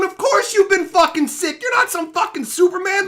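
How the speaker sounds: loud, heated scolding; angry yelling. Real, not acted. Streamer yelling at a viewer who claims immunity. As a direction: tear into him for real.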